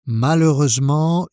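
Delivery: ordinary delivery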